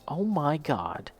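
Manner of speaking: high, hushed dawning realization; retold amazement. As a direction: draw it out as the penny drops. Retelling moment of recognising the voice.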